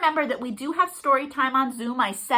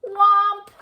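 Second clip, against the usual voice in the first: mock thud; 8 semitones higher, 6 dB louder than usual. Porridge falls on Norris.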